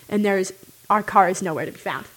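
ordinary talk

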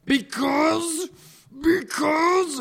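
high, loud, drawn-out dramatic because; milk it like a theatrical villain; dramatic pause. Acted. Hagrid pausing dramatically before his answer. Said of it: "he paused in the air dramatically".

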